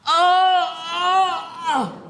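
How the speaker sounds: high moaning cries of pain; pained groans. Acted. Patient in hospital groaning with pain, high moans. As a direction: moan in agony.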